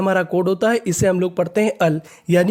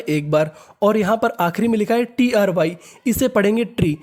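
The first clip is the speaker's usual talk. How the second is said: ordinary talk, nothing marked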